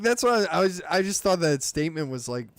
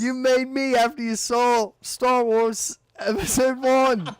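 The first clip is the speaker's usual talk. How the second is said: high, half-laughing Aussie voice; hammy Australian teasing; mock Australian accent; 7 semitones higher than usual. Teasing his friend as Aussie Jake, mock Australian. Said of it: "my Australian accent impression".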